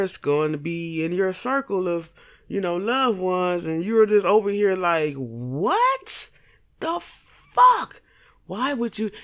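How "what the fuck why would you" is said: stunned, caught off guard by the news; shocked disbelief. Retold or imitated. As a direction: pitch jumps way up on the fuck, almost a cry. Acted reaction to bad news.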